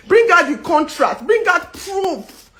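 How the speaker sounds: high, forceful, slowing demand; indignant demand for proof. Real, not acted. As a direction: challenge them firmly. Demanding evidence.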